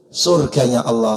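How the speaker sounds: ordinary talk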